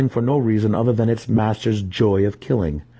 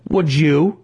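high, louder mocking question; scornful retort; 8 semitones higher, 5 dB louder than usual. Dinan mocking his brother's question, scornful louder retort. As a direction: throw it back with scorn.

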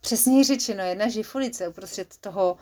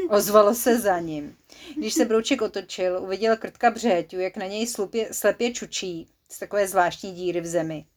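usual speaking voice

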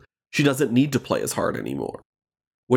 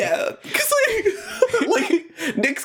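laughing; much slower than usual. Real, not acted. Laughing at the Nick impression.

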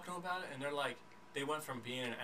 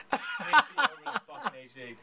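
loud laughing retake of the line; laughing louder repeat; 4 semitones lower, 7 dB louder than usual. Shown as a demonstration. Repeats line, second time emphatic.

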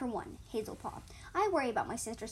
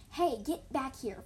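loud hiss; 3 semitones higher, 5 dB louder, slower than usual. A narrator's reading. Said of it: "Flame Paw hissed loudly".

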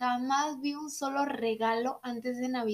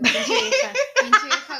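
laughing mock insult; 9 semitones higher, 7 dB louder, slower than usual.

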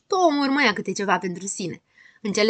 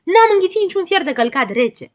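high, loud outraged protest; yelling; 11 semitones higher, 7 dB louder than usual. Acted. Grăbilă denies the poem about him, yelling. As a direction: protest at the top of your pitch. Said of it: "țipă Grăbilă".

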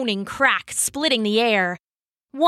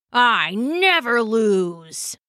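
very slow, heavy, menacing words; growling monk; much slower than usual. Acted. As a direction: grind each word out like a threat. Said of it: "the monk growled".

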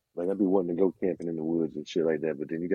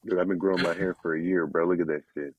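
usual speaking voice

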